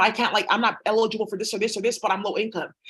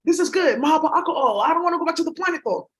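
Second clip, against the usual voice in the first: happy auntie; 6 semitones higher than usual. Retold or imitated. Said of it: "She's just happy that".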